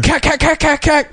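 high mouth gun noises; imitated gunfire. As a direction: spit out cartoon gunshots. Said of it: "and he's like".

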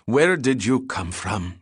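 slow, harsh demanding woman's voice. A narrator's reading. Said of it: "she demanded".

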